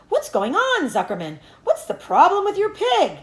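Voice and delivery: high, loud announcer call over the fair. A narrator's reading. Fair announcer booming at Zuckerman, loud high call. Loudspeaker calling across fair.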